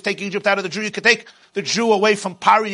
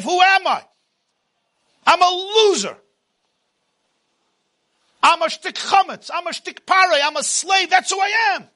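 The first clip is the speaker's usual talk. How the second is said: despairing shout; 10 semitones higher than usual. Acted. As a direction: build to a shouted outburst of self-loathing. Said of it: "That is the voice of Pare".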